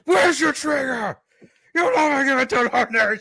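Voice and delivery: shouted dog-growl Batman. Pretend. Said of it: "went full dog growl".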